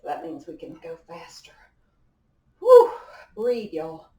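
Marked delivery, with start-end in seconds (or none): 2.6-2.9 loud, high, winded blow of air; a real gasping exhale of effort; breathless whew. Real, not acted. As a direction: blow it all out hard after the set. Winded after fast arm pumping.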